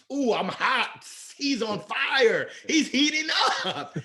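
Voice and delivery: high, laughing "he's heating up" call; hyped announcer call. Retold or imitated. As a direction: hype it like an NBA announcer. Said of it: "I'm kinda like Antoine Walker".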